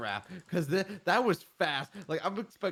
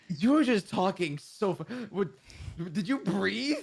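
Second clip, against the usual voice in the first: loud, higher exclamation; amazed outburst; 7 semitones higher, 4 dB louder than usual. Real, not acted. Friend teasing fast-talking streamer, loud amazed outburst. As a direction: blurt it out in disbelief.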